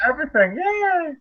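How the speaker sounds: bright squeal of yay; excited cheer. Real, not acted. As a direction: gush it out with delight. Gushing cheer mid chat.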